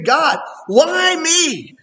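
drawn out high and slow, asking why me; slow plea. Retold or imitated. Preacher recalls whining to God, slow high plea. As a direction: stretch it out like a wounded complaint.

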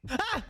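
high startled yelp; scared yelp. Real, not acted. Sudden scare.

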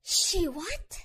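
shocked anger. Acted. Confronting boyfriend about Kelly.

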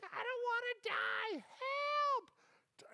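very high mock cry for help; imitated screaming girl. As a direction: squeal it like a panicked girl, without full volume. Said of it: "a girl screaming".

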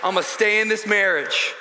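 high, loud shouted sermon; full-force preaching. Acted. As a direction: build to a shouted rallying cry for the congregation.

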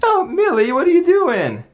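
high, fond scolding of a puppy. Real, not acted. Talking to the puppy again.

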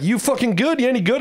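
high, teasing challenge; ribbing him. Retold or imitated. To the street rapper, a high teasing challenge. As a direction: needle him like you doubt he's any good. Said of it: "breaking his balls right back".

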